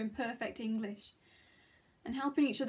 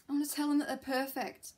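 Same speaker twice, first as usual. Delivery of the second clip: ordinary talk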